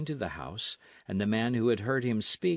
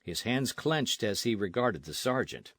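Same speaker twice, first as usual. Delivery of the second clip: normal talking voice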